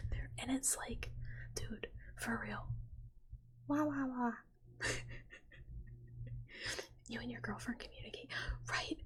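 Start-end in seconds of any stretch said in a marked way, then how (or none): 3.7-4.3 raised, cat-like wail; playful kitty cry, pitched up; fake cat noises. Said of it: "I love a fake little cat noise".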